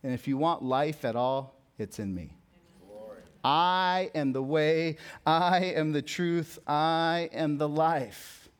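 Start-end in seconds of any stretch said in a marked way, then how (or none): 3.4-8.5 drawn-out, weighty stress on "I"; emphatic proclamation. Retold or imitated. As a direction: proclaim it slowly, stretching each "I". Preacher proclaims verse with drawn emphasis.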